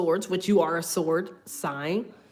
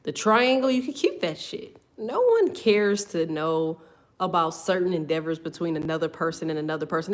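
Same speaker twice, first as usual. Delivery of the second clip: ordinary talk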